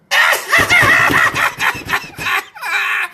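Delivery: very loud, high-pitched laugh; big cackle. Real, not acted. Comedian cracking up at own punchline, high loud cackle. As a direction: shriek with long, rolling laughter.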